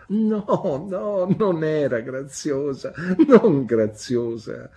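high, loud, drawn-out denial from the mother; interrupting protest. A narrator's reading. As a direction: cut in loudly and stretch the denial. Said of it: "interruppe mia madre". (protesta acuta e forte che interrompe)

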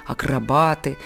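usual speaking voice

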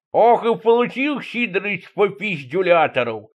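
high, slow put-on character voice; drawn-out, high-pitched bragging; boastful diver. A narrator's reading. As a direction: crow it slowly in a silly high voice. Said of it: "похвастался водолаз".

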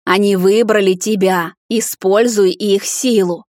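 loud, slow, commanding call; loud stretched-out command; demanding voice. A narrator's reading. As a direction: urge with slow, forceful authority. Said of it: "вновь раздался требовательный голос".

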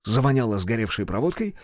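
plain reading voice

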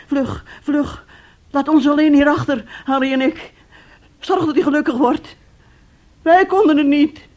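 urgent plea. Acted. Aunt urgently sending the niece away with Thomas. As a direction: push her out the door with urgency.

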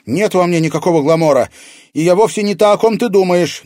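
put-on high, loud girl's protest; heated denial. A narrator's reading. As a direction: deny it urgently to the captor. Girl hotly denies the villain's claim.

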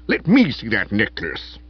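commanding lordly voice; authoritative. A narrator's reading. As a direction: demand it with authority. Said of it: "he said authoritatively".

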